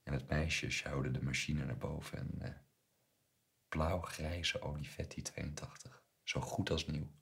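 usual speaking voice

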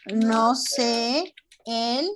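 very slow, drawn-out sentence left open; slow prompting. Shown as a demonstration. Teacher prompts pupils to fill the word, very slow. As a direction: stretch it out and leave the gap.

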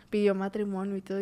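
ordinary talk, nothing marked

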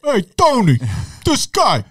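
high, jokey wiseguy voice; mobster voice. Pretend. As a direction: play a cartoon mobster calling his pal. Joking mobster voice.

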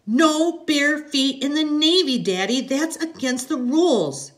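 put-on high, loud, slow child's scolding; little girl's drawn-out high reproof; bossy little girl. A narrator's reading. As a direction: lay down the rules like a small daughter. Little girl scolds her father.

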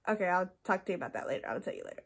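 low, quick, soft retold aside; hushed aside. Friend putting off talk about that later, hushed quick aside. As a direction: drop it to a hurried murmur.

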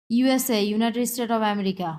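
ordinary delivery

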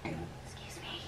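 soft unvoiced whisper. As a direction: breathe it secretly. Whispering so no one hears.

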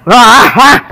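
sharp high pants through a burning mouth; panting from spicy heat. Real, not acted. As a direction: huff out air, cooling a burnt tongue. Mouth on fire from chili.

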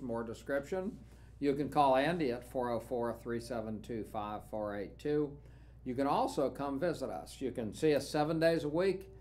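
usual speaking voice

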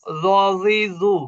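রজিজু stretched very slowly, each syllable held; slow drawn-out drill. Shown as a demonstration. Teacher stretching the syllables slowly for students. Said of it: "ওই আগা থেকে সাসিসু".

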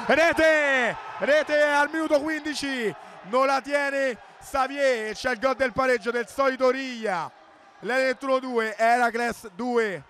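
high, excited goal call; breathless italian goal commentary. Real, not acted. Equaliser called, score read.

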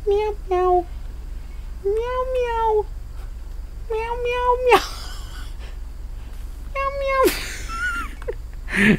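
tiny, very high kitten mews; cat meowing. Acted. Streamer answering a strange noise, kitten voice. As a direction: mew softly way up high.

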